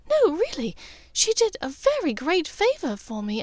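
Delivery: a hint of grateful young woman; lightly voiced Hermione. Hermione insisting.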